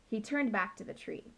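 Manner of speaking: plain reading voice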